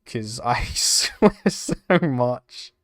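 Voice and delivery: slow, laughing admission. Real, not acted. Streamer explaining channel isn't for kids, laughing. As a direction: let a laugh break up the words. Admits swearing, laughing through it.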